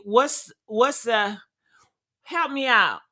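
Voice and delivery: ordinary talk, nothing marked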